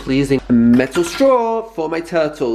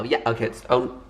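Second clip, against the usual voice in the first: ordinary talk, nothing marked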